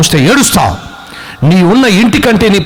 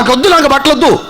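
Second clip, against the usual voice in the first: high-pitched mock child protest; sulky refusal; 8 semitones higher, faster than usual. Child to parents over cheaper clothes, acted sulky refusal.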